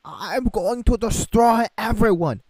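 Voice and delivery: high, loud, slow villain threat; shouted cartoon dictator voice; mock Kim Jong-un. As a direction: ham up a ranting dictator.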